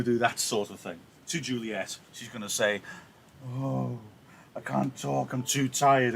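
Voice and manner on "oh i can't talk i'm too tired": feigned tiredness. Acted. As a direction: wind her up with mock exhaustion. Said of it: "and wind her up".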